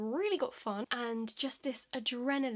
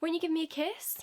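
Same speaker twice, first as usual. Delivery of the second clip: loud, higher wheedling plea; imitated pushy suitor; 5 semitones higher, 5 dB louder than usual. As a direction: pester for a kiss, mocking him. Imitates pushy man begging kiss.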